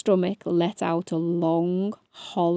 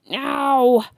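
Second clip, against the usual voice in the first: louder pained howl; 3 semitones higher, 5 dB louder than usual. A narrator's reading. Fox pricked by spikes, loud howl. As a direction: howl it out in pain. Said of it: "howled the fox".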